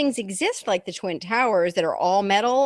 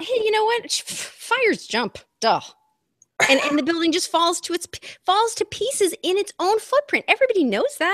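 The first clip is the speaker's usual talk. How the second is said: sarcastic mock; 9 semitones higher than usual. Sarcastic mock of official story.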